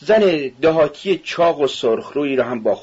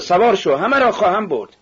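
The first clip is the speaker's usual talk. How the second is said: high, eager call aboard; rousing call; 9 semitones higher than usual. A narrator's reading. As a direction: call out high, inviting everyone. Carter calls everyone aboard. (دعوت بلند و پرشور)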